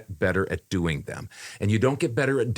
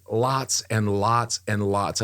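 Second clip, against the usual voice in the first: each "lots" drawn out slowly; stress on LOTS; slower than usual. Real, not acted. Telling co-host about endless retakes, heavily stressed LOTS. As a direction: lean slowly on every repeated "lots". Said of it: "I'm not overemphasizing this".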